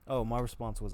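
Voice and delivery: ordinary talk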